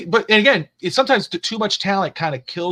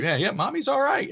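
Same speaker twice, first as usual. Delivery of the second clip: put-on higher, teasing little-girl voice; mock kid voice; 11 semitones higher than usual. Mom teasing wife, in a light high kid voice. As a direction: tease like a playful kid. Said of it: "I'm like".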